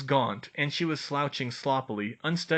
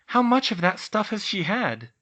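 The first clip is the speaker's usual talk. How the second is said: Hermione voice; 9 semitones higher, 5 dB louder than usual. A narrator's reading. Hermione asking.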